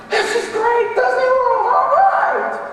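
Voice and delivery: very high, loud, gushing delight; excited happy visitor. Pretend. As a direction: squeal like a thrilled tourist. Happy person at Disney World.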